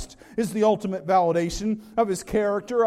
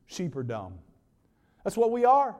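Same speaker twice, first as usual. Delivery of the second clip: ordinary talk, nothing marked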